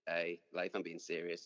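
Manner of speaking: ordinary talk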